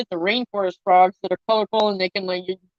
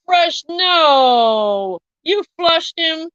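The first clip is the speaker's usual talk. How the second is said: drawn-out, dismayed no, then higher disbelief; aghast protest; 6 semitones higher, much slower than usual. Real, not acted. Reacting to Fresh flushing him, aghast and drawn out. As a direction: stretch it out in appalled disbelief.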